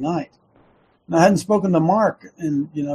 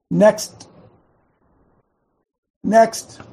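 each next louder and higher; building next; 4 semitones higher, 5 dB louder than usual. Shown as a demonstration. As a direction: call out next, rising. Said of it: "Can you guys say that?".

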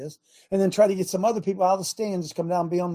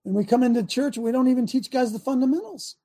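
normal talking voice

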